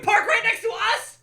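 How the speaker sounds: high, indignant yell; mock outraged shout, laughing. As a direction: shout it in disbelief at the neighbours. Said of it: "I'd have been like".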